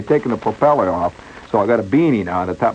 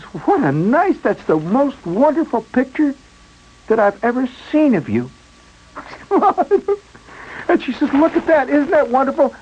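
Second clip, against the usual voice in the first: gushing mother. Retold or imitated. Mother gushing over the photo.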